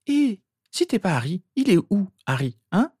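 high-pitched mocking girl. A narrator's reading. Rose challenging the boy claiming not to be Harry, mocking. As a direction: tease him in a high, mocking voice. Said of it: "demanda Rose d'une voix moqueuse".

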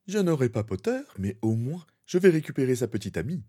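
high, haughty villain voice. Acted. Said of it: "lança une voix hautaine". (voix hautaine et haut perchée)

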